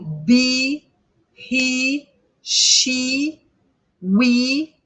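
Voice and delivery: "be, he, she, we" each with a long "ee"; sustained ee in "be, he, she, we", word by word; long I sound. Shown as a demonstration. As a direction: stretch the final E of "be, he, she, we". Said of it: "e um I bem longo".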